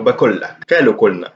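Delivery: بقول لك, قالوا قلنا with a plain front k for each qaf. Shown as a demonstration. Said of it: "it is actually pronounced as kaf".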